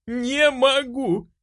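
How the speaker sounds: high, anguished outburst; desperate cry. A narrator's reading. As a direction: cry out helplessly, pitched very high. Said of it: "закричала я".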